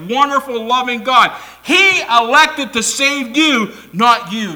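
impassioned preacher building in force; full-force preaching. Real, not acted. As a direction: drive each key word home to the congregation.

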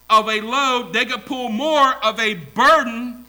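loud, high, driving preacher delivery; emphatic preaching. Real, not acted. Preacher to congregation on pulling together, emphatic.

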